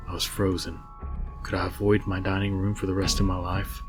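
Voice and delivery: ordinary delivery, nothing marked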